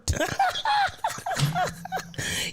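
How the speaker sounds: long high cackling laugh. Real, not acted. Host laughing at the chat's joke, high cackle. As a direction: let the laugh run high. Chat makes her laugh.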